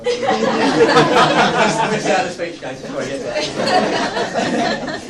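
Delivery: laughing. Real, not acted. Teasing the groom, laughing.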